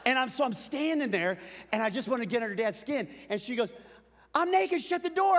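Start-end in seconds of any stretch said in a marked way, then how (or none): 4.3-5.4 high, loud mock protest; imitating yelling mom. Mom playing along with the prank, high loud protest. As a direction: cry it out high in pretend alarm.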